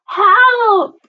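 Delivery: high, loud cry for help. A narrator's reading. Frightened sister on the bridge, crying out. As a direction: yelp it in storybook panic. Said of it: "the other cried out".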